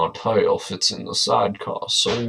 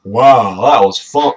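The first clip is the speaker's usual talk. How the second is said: a bright loud wow; excited dino cheer; 3 semitones higher, 10 dB louder, slower than usual. Shown as a demonstration. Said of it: "No, wait, fuck".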